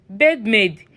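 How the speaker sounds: ordinary delivery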